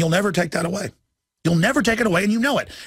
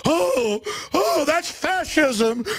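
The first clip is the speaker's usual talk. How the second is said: high, slow, prissy mock offense; much higher, drawn-out scoffing whine; mock gasp of outrage; 11 semitones higher, much slower than usual. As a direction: parody a clutching-pearls objection. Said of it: "mock reaction to his line".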